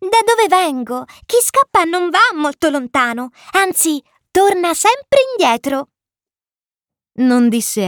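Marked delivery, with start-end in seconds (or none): 0.0-5.9 put-on high child's voice, explaining; amused little prince. A narrator's reading. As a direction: explain it in a small piping voice. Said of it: "rispose voltandosi un'ultima volta verso di me divertito". (vocina acuta e divertita)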